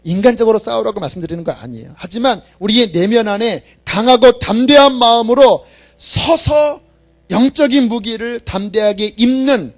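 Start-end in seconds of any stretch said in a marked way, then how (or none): 1.9-9.7 loud, high, rousing sermon climax; preaching at full force. Real, not acted. Preacher rousing the congregation to stand boldly, loud and high. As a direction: build the call to boldness.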